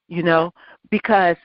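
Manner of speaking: usual speaking voice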